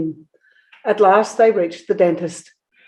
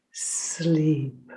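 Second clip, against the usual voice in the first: softer whispered sleep; hissed whisper; 2 semitones lower, 7 dB quieter than usual. A narrator's reading. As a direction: fade the sleep to a hush. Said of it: "she hissed".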